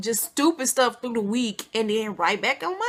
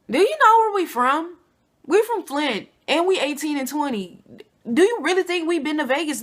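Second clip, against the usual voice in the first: incredulous loud retort; 6 semitones higher, 6 dB louder than usual. Retold or imitated. Girls answering his Vegas question.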